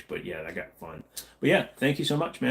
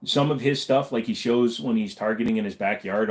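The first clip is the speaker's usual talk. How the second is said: normal talking voice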